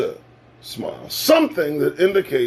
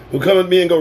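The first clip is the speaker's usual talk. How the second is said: normal talking voice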